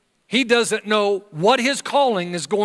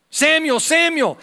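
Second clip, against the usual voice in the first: higher, louder call of the name Samuel; 10 semitones higher, 5 dB louder than usual. Acted. Third time God calls out.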